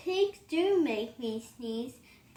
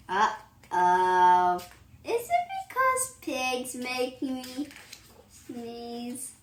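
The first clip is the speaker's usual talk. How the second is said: ordinary talk, nothing marked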